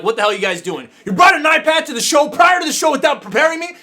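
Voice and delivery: high, loud frustrated outburst; exasperated rant. Retold or imitated. Host ranting at crew who brought an iPad unprepared, exasperated. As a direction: vent at the crew like it's a crisis.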